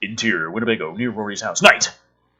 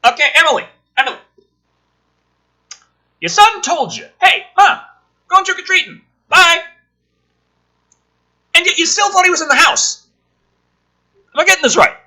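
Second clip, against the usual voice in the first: higher and louder, scolding the clueless mom in disbelief; mock disbelief; 11 dB louder, faster than usual. Ranting at the mom who didn't notice her son left, loud mock disbelief. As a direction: talk down to her like she can't be serious.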